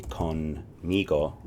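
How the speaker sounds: conmigo drawn out slowly, syllable by syllable; slow repeat-after-me. Shown as a demonstration. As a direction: stretch out conmigo for learners to copy. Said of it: "Say it with me".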